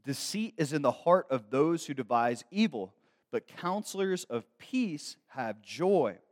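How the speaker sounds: slow, measured verse reading; solemn Bible reading from the pulpit; scripture reading. Acted. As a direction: read the verse with weight. Reads scripture verse aloud.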